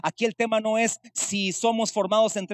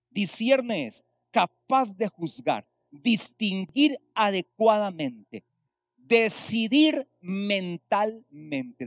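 slow definition; slower than usual. Acted. Preacher defines the word slowly.

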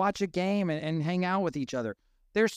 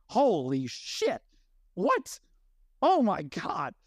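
a slow stunned curse, then a sharp high jump on the question; retold shock at meeting a friend in person; shocked disbelief; 3 semitones higher, slower than usual. Stunned, shocked reaction. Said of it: "And I was like".

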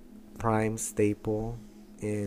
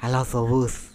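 louder mock Castilian lisp; lisping Spanish accent; 2 semitones higher, 6 dB louder than usual. Finishing the lisp demonstration, lisping Spanish accent. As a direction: lean into a lisping accent from Spain. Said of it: "So they have lisp".

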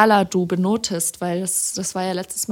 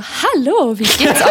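higher and louder, bright presenter greeting; high radio voice; 6 semitones higher, 9 dB louder, slower than usual. Shown as a demonstration. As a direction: raise the pitch to sound like radio. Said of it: "Also halt so".

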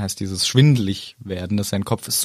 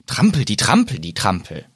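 acted trampling; 2 semitones lower than usual. Acts out footsteps Harry hears.

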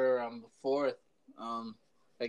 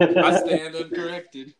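laughing; 4 semitones higher, 8 dB louder than usual. Real, not acted. Laughing after joke punchline.

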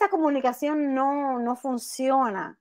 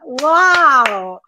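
loud delighted wow; excited; 9 dB louder than usual. Real, not acted. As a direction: burst out in delight. Reacts to the recited poem.

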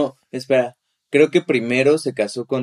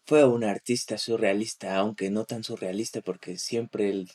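softer documentary narrator read; 5 dB quieter than usual. Shown as a demonstration. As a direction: keep the hushed nature documentary tone. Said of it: "esto es como de voz de documental".

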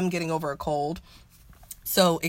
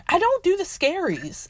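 high, insistent refusal; high emphatic; 10 semitones higher than usual. Real, not acted. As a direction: throw it up high and firm. Refusing scary show, repeated.

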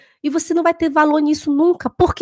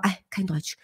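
much softer, lower, brushing it off; quiet dismissive quote; 5 semitones lower, 10 dB quieter than usual. Retold or imitated. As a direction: mutter a weary refusal. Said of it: "eu falava".

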